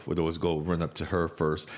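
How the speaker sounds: normal talking voice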